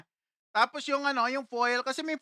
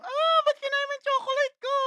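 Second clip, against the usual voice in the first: put-on much higher wailing child's complaint; crying angry girl; 14 semitones higher than usual. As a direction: shriek at him for eating her chocolate. Crying girl scolds him. (umiiyak na galit na bata)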